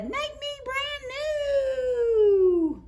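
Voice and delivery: very high, slow, drawn-out eerie voice; puddle voice. A narrator's reading. A voice in the puddle begs the children, high and slow. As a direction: stretch it out like a magic puddle calling.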